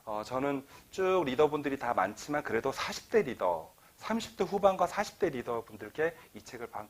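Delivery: ordinary delivery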